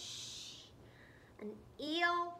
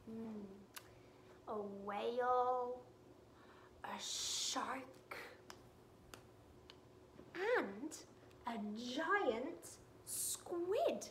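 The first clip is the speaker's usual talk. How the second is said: plain reading voice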